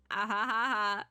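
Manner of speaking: deliberate, spelt-out "ha ha" laugh; hammed-up staged laughter; mock laugh. Orbit scoffing at the magnifying glass, mock laugh.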